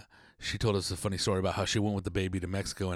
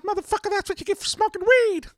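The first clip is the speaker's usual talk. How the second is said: high squeaky mocking voice, louder; mocking scold; 5 dB louder than usual. Mocking himself over the bad sperm sample, high squeaky scold. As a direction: scold like a nagging cartoon voice. Scolding self about weed.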